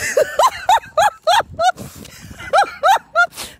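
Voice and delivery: high, long, real cackle of laughter; high burst of laughter. Real, not acted. Laughing at her ventriloquist devil joke, high cackle.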